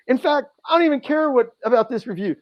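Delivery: high and loud, angry; furious outburst. Retold or imitated. Insulting the boss at the review, high loud fury. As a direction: blurt it out at the top of the anger. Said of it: "getting angrier and angrier".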